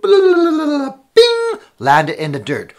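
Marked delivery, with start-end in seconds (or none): 0.0-1.6 high, loud ringing bing; falling drop noise. Acted. Storyteller imitates one drop of honey landing, high ringing bing. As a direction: make a drop land with a ping.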